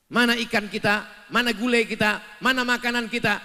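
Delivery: mild mock demand; demanding husband. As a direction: demand it from the wife.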